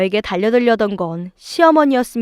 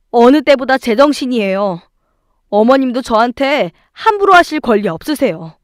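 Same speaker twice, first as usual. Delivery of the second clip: louder, lifted voice building in indignation; defiant retort; 3 semitones higher, 5 dB louder than usual. Acted. Wife talking back to mother-in-law, defiant retort. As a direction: argue back, pressing harder toward the end.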